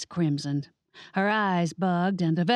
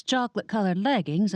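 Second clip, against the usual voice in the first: ordinary delivery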